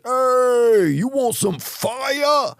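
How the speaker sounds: high, slow mock firefighter offer; rowdy firefighter. Firefighter at a party offering fire, high and slow. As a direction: drawl it out like a party host.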